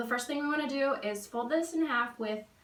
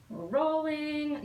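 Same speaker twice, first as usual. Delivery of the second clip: drawn out, higher and slower, sing-song; playful announcement; 5 semitones higher, slower than usual. Real, not acted. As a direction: announce it like a fun moment. Playful moment rolling the burrito.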